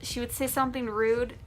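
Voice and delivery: usual speaking voice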